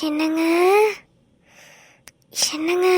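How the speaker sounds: sultry wifely call; husky voice. Acted. Wife wakes sleeping husband in a husky voice. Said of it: "நீ husky வாய்ஸ்ல பேசிட்டு இருக்க".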